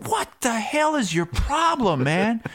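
high, indignant mock challenge; confrontational. Reporter challenging the staffer, high indignant. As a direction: call him out sharply. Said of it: "and so I said".

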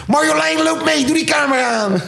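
high, urgent mock calling; Boevenspotter. Thief spotter summoning companion to film, high urgent impression. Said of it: "De boevenspotter".

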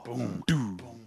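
low, soft, slow booms; imitated drum beat. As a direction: boom out a slow rowing beat under your breath. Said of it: "ancient Roman drum dudes".

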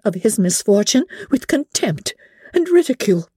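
high, mounting indictment without shouting; energetic accusation. A narrator's reading. Elizabeth charges Darcy with ruining Wickham, energetic. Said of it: "cried Elizabeth with energy".

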